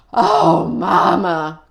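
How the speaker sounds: relieved cry. Acted. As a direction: cry out happy to see mom. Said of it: "cried Maxwell".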